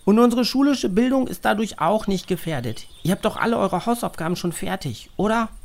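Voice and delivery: checking with friends; girl voice. A narrator's reading. Narrator voices a child.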